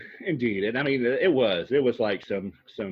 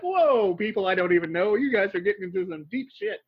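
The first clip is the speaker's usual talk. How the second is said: high, loud mock-startled "whoa"; retold shock; 8 semitones higher, 4 dB louder than usual. Viewer reacting to strangers fighting, mock shock. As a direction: react in exaggerated alarm, pitched up.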